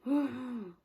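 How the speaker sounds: a loud, long weary sigh; heavy sigh. Real, not acted. Heartbroken over her sister's date, a loud sigh. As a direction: let the breath out all at once.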